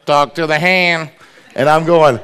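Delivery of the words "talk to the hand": louder, put-on diva voice; sassy dismissal. Daughter Mariah snubbing someone who made her mad, loud sassy voice. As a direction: brush them off with full sass. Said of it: "she was just like".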